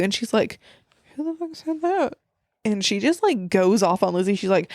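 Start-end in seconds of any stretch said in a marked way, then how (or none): 1.1-2.1 affronted mock retort. Acting Lizzie's affronted retort.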